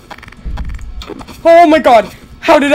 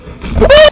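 very high startled gasp; sharp gasp; 10 dB louder than usual. Real, not acted. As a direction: gasp in sudden shock.

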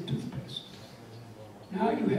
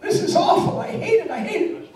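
much higher, loud angry rant; furious shout; 9 semitones higher, 6 dB louder than usual. Acted. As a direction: yell at the communist system in rage. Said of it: "Starts shouting".

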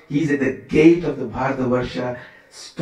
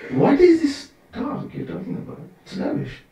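leaping high, mocking disbelief then dropping away; high mocking scoff fading to a hush; scornful dismissal; 12 semitones higher than usual. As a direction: scoff at nonsense. Sage dismissing the king's talk.

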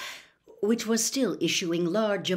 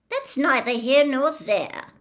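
coldly; 6 semitones higher, 6 dB louder than usual. Acted. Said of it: "said Ogden coldly".

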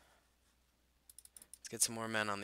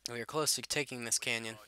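ordinary talk, nothing marked

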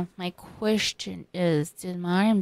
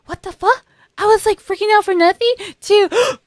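shocked; 13 semitones higher, 8 dB louder, faster than usual. Retold or imitated. Marin's first reaction retold.

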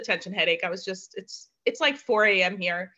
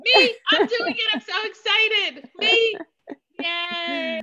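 much higher, bubbling eager me me me; eager excitement; 11 semitones higher, slower than usual. Real, not acted. Claiming the honour of giving the charge, thrilled and eager.

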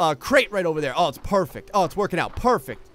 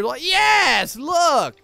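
excited; 8 semitones higher, 4 dB louder, much slower than usual. Real, not acted. Spots his guard at entrance.

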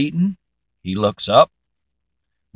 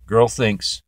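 ordinary delivery

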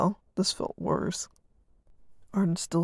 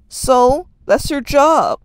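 loud retort; 8 semitones higher, 14 dB louder, much slower than usual. Acted. Brothers arguing loudly.

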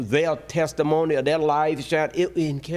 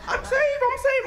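mock excited. Mock excited new believer.

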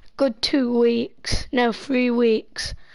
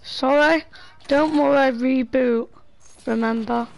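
ordinary talk, nothing marked

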